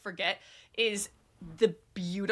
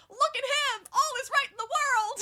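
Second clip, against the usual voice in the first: excited cheer. Retold or imitated. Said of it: "he goes".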